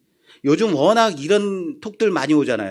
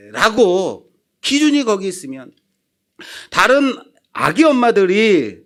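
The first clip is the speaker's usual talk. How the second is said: usual speaking voice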